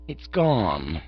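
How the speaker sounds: calm, unruffled manservant; Jeeves the valet. Acted. Jeeves reports the hat gone.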